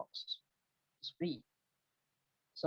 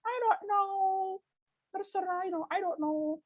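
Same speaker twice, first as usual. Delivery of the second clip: indecisive woman. Retold or imitated. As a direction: play the woman who just can't choose. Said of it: "A lot of women will say".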